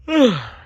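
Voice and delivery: loud high sigh of relief; relieved sigh. A narrator's reading. Animals sighing with relief as the lorry stops. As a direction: let the breath go.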